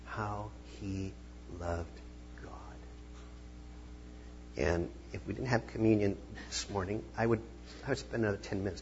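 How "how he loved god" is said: slow, fading to a whisper; hushed awe. Retold or imitated. Preacher imagining people's admiration of Jesus, slow hushed whisper. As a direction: breathe it out in wonder. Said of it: "look at me and say".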